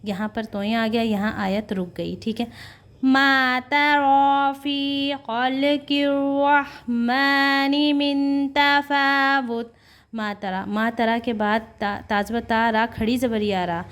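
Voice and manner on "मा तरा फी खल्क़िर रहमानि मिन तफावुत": slow, high, drawn-out Quranic chant; chanted recitation, higher and slow. Shown as a demonstration. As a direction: intone each word slowly with tajweed. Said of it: "Quran recitation demo".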